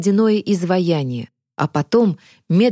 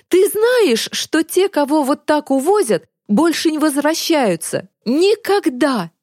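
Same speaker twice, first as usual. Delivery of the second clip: high-pitched anguished tirade, climbing higher; accusation building to shouting; 7 semitones higher than usual. A narrator's reading. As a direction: rage at a friend over mother's arrest. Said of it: "Захар перешёл на крик". (гневно, срываясь на крик)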